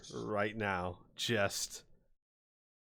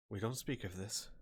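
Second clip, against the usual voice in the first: softly, lower, keep it between you; hushed warning; 4 semitones lower, 11 dB quieter than usual. Acted. Claire handing back the crossbow to Kieran, hushed warning. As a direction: say it low and softly, as a secret.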